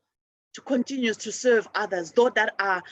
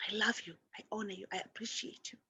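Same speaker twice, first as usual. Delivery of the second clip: whispered prayer, fast and hushed; a soft whispered blessing; 15 dB quieter, faster than usual. Real, not acted. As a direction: breathe the words almost silently. Whispered Ho'oponopono prayer to phone's soul.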